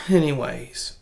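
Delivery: normal talking voice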